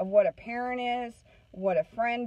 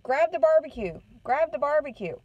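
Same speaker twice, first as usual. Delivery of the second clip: higher-pitched imitation of an impatient mom; retold bossy command; impatient order; 5 semitones higher, 4 dB louder than usual. Retold mom ordering her child.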